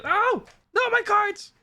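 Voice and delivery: very high startled squeal; real dismay. Real, not acted. Streamer dropping the cards on stream, high squealed dismay. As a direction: yelp as it slips from your hands.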